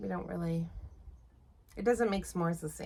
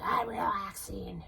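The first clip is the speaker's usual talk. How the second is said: laughing through a cranky claim of relaxing; laughing protest; slower than usual. Retold or imitated. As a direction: insist you're relaxing while cracking up. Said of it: "I'm like".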